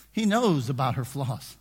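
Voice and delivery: ordinary talk, nothing marked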